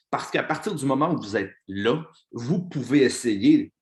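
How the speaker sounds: loud, driving coach's pep talk; fired-up pep talk. Real, not acted. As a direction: preach it to the team at full force. Pep talk at full force.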